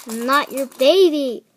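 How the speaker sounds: usual speaking voice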